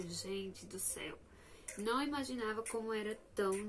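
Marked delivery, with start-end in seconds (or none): 0.0-1.2 breathy whispered exclamation, slow; whispered gente do céu, slow and soft; whispered aside. Real, not acted. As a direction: drop to a hushed whisper in disbelief. Aside after anxious retelling.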